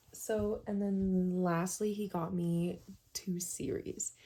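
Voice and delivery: ordinary delivery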